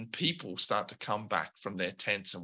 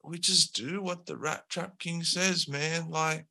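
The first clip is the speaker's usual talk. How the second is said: slacker henchman; 6 semitones higher than usual. Acted. Henchman shrugging off blame to Al, higher and casual. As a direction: play a lazy lackey who just follows orders. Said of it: "The early adopter ... says".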